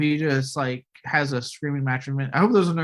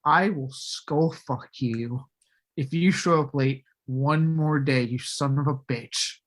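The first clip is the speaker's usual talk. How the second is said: slow menace; slower than usual. Retold or imitated. Director in Vin's face, slow mock threat. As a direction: lay it out slowly like a threat. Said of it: "He just gets in his face".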